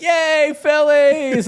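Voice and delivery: high-pitched imitated kids' cheer; dziecięcy wiwat. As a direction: cheer like a little kid. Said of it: "my kids are like".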